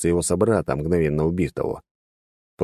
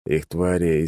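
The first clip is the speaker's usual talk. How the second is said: ordinary delivery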